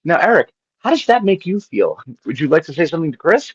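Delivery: high, gentle counselor tone; therapist voice. Retold or imitated. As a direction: coax him like a therapist. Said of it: "and be like".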